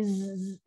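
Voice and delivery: bare buzzing "z"; low zai. Shown as a demonstration. Teacher isolates zai for students, buzzing z. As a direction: sustain "z" with the tongue low. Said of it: "zai bawah".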